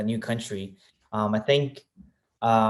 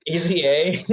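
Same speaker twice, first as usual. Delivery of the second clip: laughing; 8 semitones higher, 4 dB louder, slower than usual. Real, not acted. Laughing along at easy credit.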